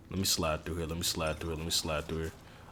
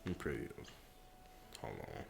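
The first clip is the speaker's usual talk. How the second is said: muttered; 13 semitones lower, 23 dB quieter than usual. Real, not acted. Muttered under breath.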